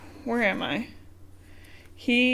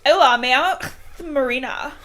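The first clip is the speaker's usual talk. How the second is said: put-on Marina in a Spanish accent, higher; 4 semitones higher than usual. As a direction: introduce yourself as a Spanish girl, Spanish accent. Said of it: "Like Spain Spanish".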